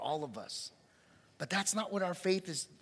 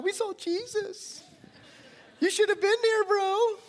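high, loud bragging buddy voice; excited disciples; 8 dB louder than usual. Acted. Disciples boasting to doubting Thomas, high excited voice. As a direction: brag to him like a friend.

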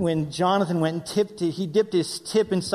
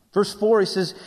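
normal talking voice

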